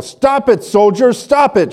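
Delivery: very high, loud frantic shrieks; hysterical child screaming. Retold or imitated. Hysterical girl screaming at soldiers whipping Jesus, frantic high shriek. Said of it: "she screamed".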